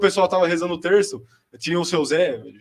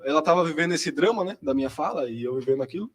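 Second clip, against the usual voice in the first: ordinary delivery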